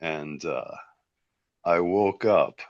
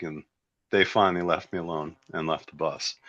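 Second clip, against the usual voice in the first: normal talking voice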